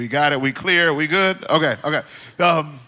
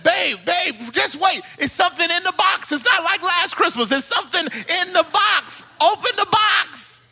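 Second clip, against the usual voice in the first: high-pitched, insistent husband urging his wife; urgent pleading; 11 semitones higher, 4 dB louder than usual. Retold or imitated. Husband urging upset wife to open.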